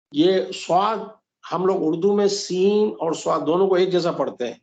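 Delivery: normal talking voice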